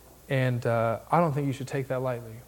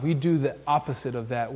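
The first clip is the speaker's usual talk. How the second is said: normal talking voice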